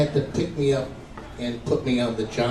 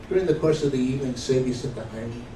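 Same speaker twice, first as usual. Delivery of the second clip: ordinary talk, nothing marked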